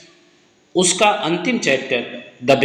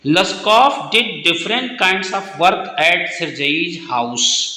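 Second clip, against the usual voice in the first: slow dictation; much slower than usual. Shown as a demonstration. Teacher dictates answer slowly.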